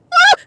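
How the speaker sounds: high-pitched whoop; exasperated woo. Real, not acted. Woman still mad about bathroom, exasperated woo. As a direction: vent in one high "woo".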